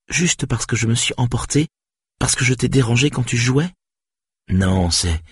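protest, voice climbing in disbelief; upset Saga. Acted. Saga protests to Stéphane, lightly voiced and rising in distress.

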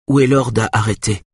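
ordinary delivery, nothing marked

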